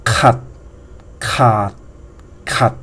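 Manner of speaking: each "kat" said low, with a pause between. Shown as a demonstration. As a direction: separate low-pitched demos of "kat". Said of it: "This is low tone without tonal marker".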